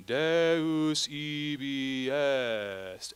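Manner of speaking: Deus ibi est, very slow and drawn out; slow Latin diction. Shown as a demonstration. Director models the phrase for choir to repeat. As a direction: stretch each Latin syllable. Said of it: "Now repeat after me".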